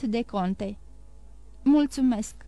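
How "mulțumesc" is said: ordinary delivery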